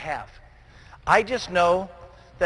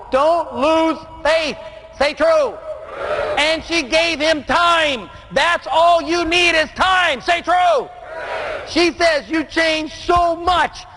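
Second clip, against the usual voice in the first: high, slow, driving preacher's build; full-force preaching; 7 semitones higher, slower than usual. Acted. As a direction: preach it to the back row, drawing each phrase out.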